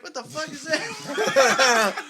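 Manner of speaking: high, slow, laughing shock; cracking up in disbelief. Retold or imitated. Rapper reacting to a wild chorus, laughing disbelief.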